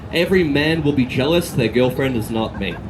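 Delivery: ordinary talk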